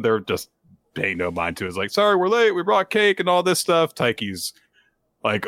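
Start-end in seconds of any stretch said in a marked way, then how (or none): 1.9-3.9 high-pitched mock family greeting; oblivious arriving family. Podcaster as Taiki's family bringing cake late, oblivious greeting. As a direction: chirp it high, oblivious to what was interrupted.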